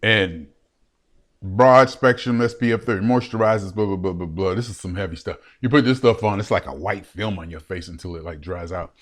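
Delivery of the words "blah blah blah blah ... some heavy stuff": ordinary delivery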